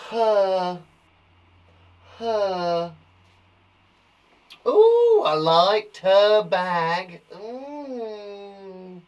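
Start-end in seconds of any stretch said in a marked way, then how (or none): 4.7-9.0 high ooh, then slow admiring words; admiring a bag. Shown as a demonstration. Teacher admiring someone's bag, delighted. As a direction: gush over a nice bag. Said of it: "That was me liking a bag".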